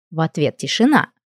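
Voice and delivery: ordinary delivery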